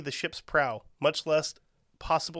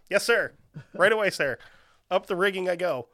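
eager higher, louder "yes sir"; eager sailor; 4 semitones higher, 6 dB louder than usual. Pretend. Sailor eagerly obeying captain's order, higher and louder. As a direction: snap to it like a keen sailor. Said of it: "And he's like".

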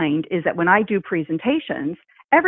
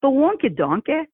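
higher-pitched Scottish grandmother voice; Scottish accent; 8 semitones higher than usual. Retold or imitated. Podcaster imitating a grandmother reading to her grandson, Scottish accent. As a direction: imitate a Scottish granny's brogue. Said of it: "But it's the way she said".

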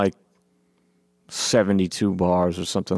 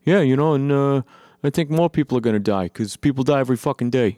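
smug nonchalant; 5 semitones higher, faster than usual. Retold or imitated. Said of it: "these smug assholes going".

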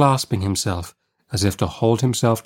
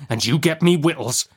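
higher, mounting convict demand; menacing convict; 6 semitones higher than usual. Acted. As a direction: push the order harder, pitched up.

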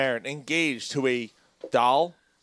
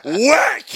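mock whack; 8 semitones higher, 7 dB louder than usual. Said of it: "he goes".

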